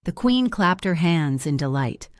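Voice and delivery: usual speaking voice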